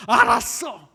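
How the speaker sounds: loud, high exasperated reply; fed up, snap it out loud; sleepy son cutting off nagging mother; irritated shout. Acted.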